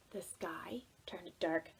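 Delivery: slow, unvoiced whisper; hushed narration. A narrator's reading. Storyteller to children as the sky darkens, whisper. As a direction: whisper it ominously.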